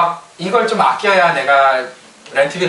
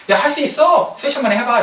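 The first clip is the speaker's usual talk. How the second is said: much higher, bright pushy encouragement; hammed-up cheerful urging; mock pep talk; 10 semitones higher than usual. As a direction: boss cheering a worker on. Boss pushing impossible deadline.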